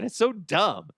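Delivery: ordinary talk, nothing marked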